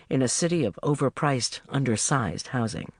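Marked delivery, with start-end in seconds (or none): none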